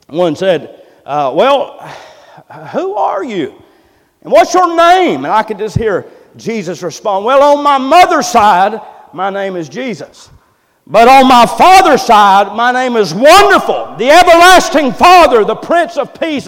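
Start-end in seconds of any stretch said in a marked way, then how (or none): 1.3-5.2 questioning doctors. Retold or imitated. Doctors questioning the boy Jesus. 7.2-16.5 high, loud proclaiming as Jesus; preaching at full force. Acted. Preacher voicing Jesus to the doctors, loud and proclaiming.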